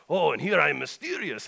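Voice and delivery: hammed-up Russian Santa; North. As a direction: play up the mystery in a Russian accent. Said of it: "Russian voice".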